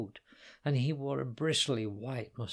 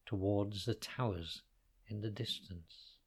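ordinary delivery

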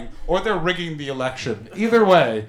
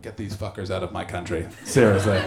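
grumbling local; 10 semitones lower than usual. Retold or imitated. Voicing Scottish audience thoughts.

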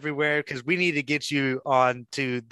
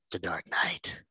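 whispering; 7 semitones lower, 8 dB quieter than usual. Acted. Narrating a Batman skit.

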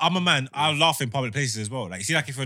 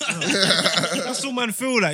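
laughing; 7 semitones higher, 3 dB louder, much slower than usual. Real, not acted. Laughs through the line.

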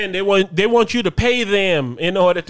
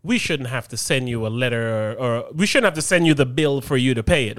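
lower, slower mock lecture voice; a lightly voiced prim office lady scolding; quoting the HOA lady's reply, lower and slow; 7 semitones lower, 4 dB quieter than usual. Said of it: "this lady gonna respond and say".

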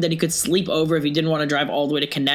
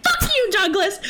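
high, squealed curse; hammed-up outburst at a friend; mock furious yell. Friends fighting with the driver in the car, mock fury.

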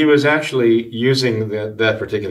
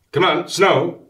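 much higher, mock pleading command; boy urging snow; 9 semitones higher, slower than usual. Retelling young Calvin waiting with his sled, high-pitched urging. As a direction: pitch it up like an impatient cartoon kid.

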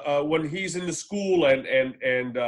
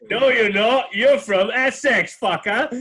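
mock retort; 6 semitones higher, 7 dB louder, slower than usual. Mocking retort to the accent.

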